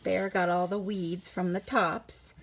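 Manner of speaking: ordinary delivery, nothing marked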